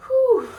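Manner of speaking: puffing out a breath; breathless whew. Real, not acted. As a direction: blow out a tired whew. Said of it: "I'm so out of breath".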